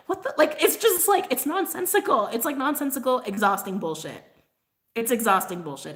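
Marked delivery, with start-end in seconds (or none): 0.1-2.3 exasperated. Real, not acted. Ranting about utility setup.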